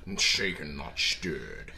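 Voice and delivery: low, lightly voiced Connery drawl on not stirred; Sean Connery impression. Friends riffing on Sean Connery, a low mock Bond purr. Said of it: "worst Sean Connery impression".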